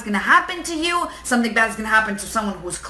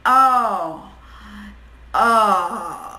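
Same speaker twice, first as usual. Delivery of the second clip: deflated groan. Retold or imitated. Acted waking up deflated.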